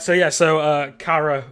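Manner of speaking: ordinary talk, nothing marked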